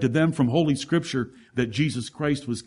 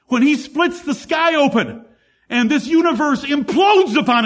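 much higher, louder, slowing at the end; climactic preaching; 9 semitones higher, 6 dB louder than usual. Acted. Preacher rising to a climax before the congregation. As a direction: build to a thundering peak.

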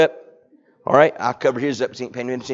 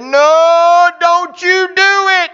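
much higher, loud panicked cry, slowed; alarmed no; 8 dB louder, much slower than usual. Acted. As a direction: shriek a frantic refusal. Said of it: "Martha said".

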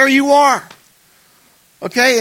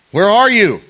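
calling out; 7 semitones higher, 8 dB louder than usual. Acted. God calling Adam.

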